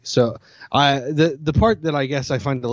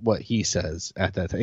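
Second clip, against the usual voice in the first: normal talking voice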